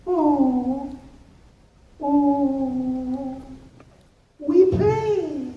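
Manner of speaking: put-on a small child caught out; little kid voice. Kid voiced answering dad.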